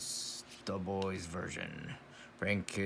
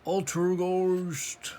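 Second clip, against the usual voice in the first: slow, high, loud cartoon voice; drawn-out cartoon announcer call; exaggerated cartoon intonation; 10 semitones higher, 7 dB louder, slower than usual. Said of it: "I talk like a cartoon character".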